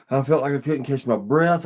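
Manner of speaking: normal talking voice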